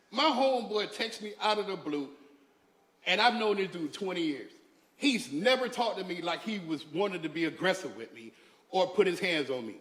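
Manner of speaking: ordinary talk, nothing marked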